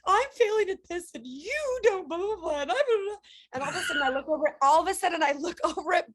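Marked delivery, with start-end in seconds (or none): 0.1-3.2 mock teary daughter. Daughters at the family meeting, in a high whiny weepy voice. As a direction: ham up a weepy teen pouring out hurt. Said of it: "everyone was like".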